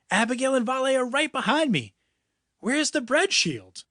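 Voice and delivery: put-on high, hurried kid voice; urgent kid voice. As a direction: press him with urgency. Finn urging, enemies close behind.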